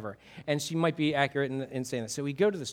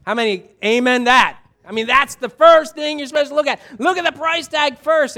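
loud, high-pitched preacher's rally; emphatic, excited call for amens; rousing exclamation; 7 semitones higher, 10 dB louder than usual. Real, not acted. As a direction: fire up the congregation, voice raised high.

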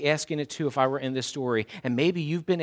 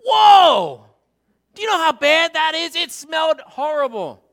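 much higher, louder disgusted exclamation; imitating his wife recoiling at the stench; shocked wife; 13 semitones higher, 11 dB louder than usual. Wife pulling into the driveway, loud disgusted shout. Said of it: "She's like".

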